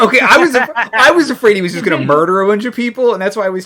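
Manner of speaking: loud, laughing through the words. Real, not acted. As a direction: talk while cracking up. Player cracks up at GM's joke.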